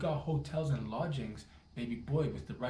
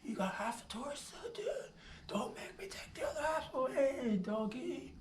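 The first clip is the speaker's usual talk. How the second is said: high, mocking taunt at the little guy; 11 semitones higher than usual. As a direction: rib him in a squeaky sneer. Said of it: "I'm like".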